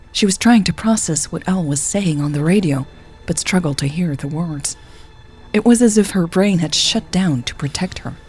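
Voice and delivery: usual speaking voice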